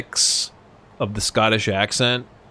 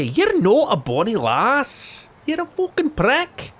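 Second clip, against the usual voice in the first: high, slow mock Scottish insult; Scottish accent; 12 semitones higher, slower than usual. Friend Sent insulting him, in hammed-up Scottish accent. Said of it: "He'd say".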